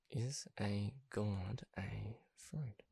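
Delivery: slow, very soft, word by word; drawn-out, quiet question to oneself; slow muttering to self. Pretend. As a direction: mutter it slowly, as if looking it up. Slowly repeats it while looking up.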